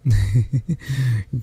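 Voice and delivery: short, inviting laugh; light little giggle; fond chuckle. Acted. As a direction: chuckle before coaxing them closer. Inviting guest closer.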